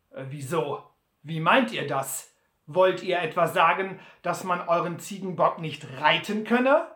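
lauter, gereizter Butler. Acted. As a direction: misstrauisch und laut nachfragen. (Butler fragt Sam lauter und gereizt)

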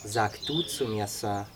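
lightly voiced, low and soft; quiet, understated correction; modest youth. A narrator's reading. Youth asking Sam for informal address, modest and quiet.

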